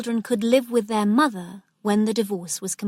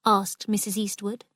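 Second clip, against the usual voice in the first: ordinary delivery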